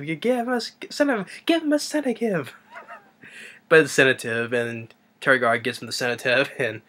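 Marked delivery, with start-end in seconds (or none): 0.0-2.5 much higher, excited blurt; Igor's realization. Retold or imitated. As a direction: blurt it out as it finally clicks. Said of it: "And then Marty's like".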